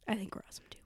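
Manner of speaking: whisper. Real, not acted. Shy agreement to co-host.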